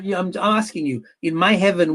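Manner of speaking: ordinary talk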